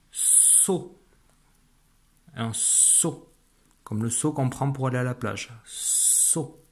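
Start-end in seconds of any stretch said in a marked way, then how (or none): 0.1-1.0 whispered word. Shown as a demonstration. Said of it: "j'entends de sons dans le mot". 5.6-6.6 a soft breathy saut for listening; whispered word. Shown as a demonstration. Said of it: "j'entends de sons dans le mot".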